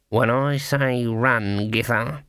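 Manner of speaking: slow, tight, held-in voice; through gritted teeth. A narrator's reading. Witch warns her friend to get ready, through gritted teeth. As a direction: force it out slowly through clenched teeth. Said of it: "said between gritted teeth".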